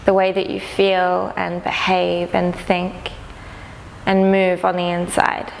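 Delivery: calm, unhurried meditation guide, long pauses between phrases; guided meditation. Acted. Teacher inviting listeners to notice sounds around them, calm guided meditation. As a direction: gently lead them inward, leaving space after each phrase.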